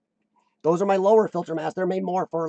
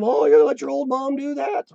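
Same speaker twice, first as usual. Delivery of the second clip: higher, louder whiny mom voice; guilt-tripping mom; 7 semitones higher, 4 dB louder than usual. Retold or imitated. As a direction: plead like a guilt-tripping mother. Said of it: "My mom".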